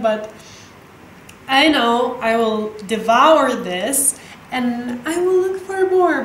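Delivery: usual speaking voice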